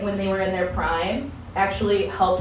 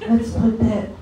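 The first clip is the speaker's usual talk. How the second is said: a hint of a chirpy nurse, laughing through it; mildly sweet caregiver tone; nursing home aide talking about grandma, lightly voiced; 3 semitones higher than usual. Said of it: "I'll be like".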